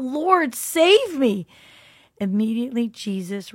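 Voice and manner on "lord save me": high, loud desperate plea; cried out in fear. A narrator's reading. Sinking Peter calling to Jesus, high loud desperate cry. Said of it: "cried out".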